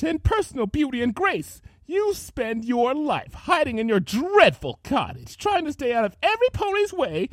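high, loud bitter tirade; jealous rant. Acted. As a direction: rant with rising fury. Rarity ranting in jealousy.

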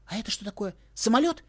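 high, loud rising guess; excited question. A narrator's reading. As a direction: shout the guess. (взволнованный возглас)

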